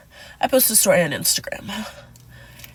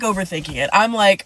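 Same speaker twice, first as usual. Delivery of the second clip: ordinary talk, nothing marked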